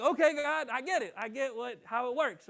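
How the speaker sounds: loud, high, delighted okay God; praying in thrilled disbelief, loud and high; excited realization. Retold or imitated. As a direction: burst out with glee.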